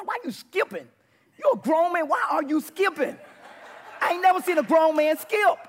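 high, very loud heckling; incredulous mocking shout. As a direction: yell in outraged disbelief, playing it for laughs. Acted mocking of friend's skipping.

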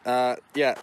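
ordinary talk, nothing marked

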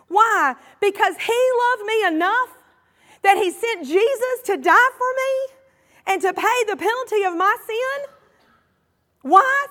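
lifted, ringing sermon voice; preaching at full force. Acted. Pastor preaching hard to the congregation, full force.

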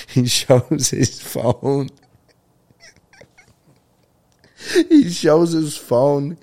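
slow, high, words squeezed out through laughter; can barely get it out for laughing; laughing while telling. Real, not acted. Host retells the Santa caller ID to listeners, laughing through it.

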